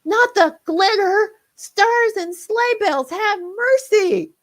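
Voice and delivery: high, loud, slow wailing plea; squealing reindeer begging for mercy; panicked scream. Acted. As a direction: shriek for mercy in terror. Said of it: "The air filled with screams".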